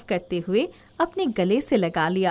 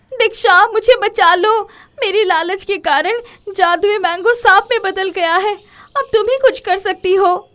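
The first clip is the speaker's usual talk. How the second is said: much higher, loud desperate pleading; panicked begging; 10 semitones higher, 11 dB louder than usual. Acted. Komal begging Diksha to save her, frantic high plea. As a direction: beg Diksha breathlessly to save you.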